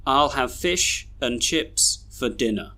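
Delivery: "and", "for" and end of "dinner" reduced to uh; slow model reading, "dinner" ending in a weak schwa; schwa weak forms. Shown as a demonstration. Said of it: "we should read these sentences like this".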